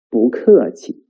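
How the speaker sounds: 不客气 with 不 rising and 客 falling; second-tone 不. Shown as a demonstration. Teacher modelling the phrase, 不客气 with a rising 不. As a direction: lift 不, drop 客 in 不客气. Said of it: "不 here is also the second tone".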